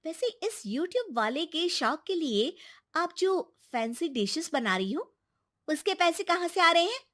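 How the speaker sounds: high-pitched, sharp questioning; high, scornful scolding woman; accusing daughter-in-law. Acted. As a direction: confront mother-in-law with indignant complaints. Daughter-in-law confronts grandmother.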